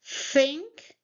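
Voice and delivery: tongue between teeth to start THINK; pronunciation demo. Shown as a demonstration. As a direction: THINK with airy th, rising pitch. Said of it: "put your tongue between your, uh, teeth and say".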